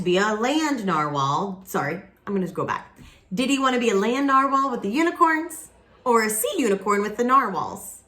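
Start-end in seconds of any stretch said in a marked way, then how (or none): none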